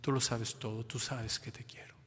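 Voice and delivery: soft, broken whisper fading out; tearful Peter. Acted. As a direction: whisper it through tears. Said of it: "llorando Pedro nuevamente".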